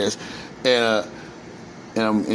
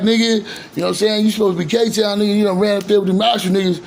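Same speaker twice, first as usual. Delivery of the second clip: higher, louder teasing voice of the local crew; mocking neighborhood guys; 9 semitones higher, 4 dB louder, faster than usual. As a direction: needle him like the guys from K-Town, pitched up. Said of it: "'cause people like".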